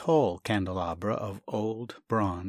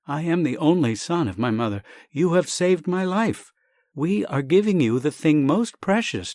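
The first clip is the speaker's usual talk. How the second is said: higher, louder insistent plea; impassioned plea; 5 semitones higher, 5 dB louder than usual. Acted. Only son urging the doctor, louder impassioned plea. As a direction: press the gift with heartfelt insistence.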